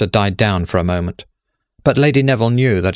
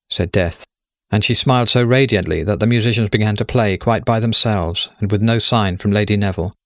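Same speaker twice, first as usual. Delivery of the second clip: ordinary delivery, nothing marked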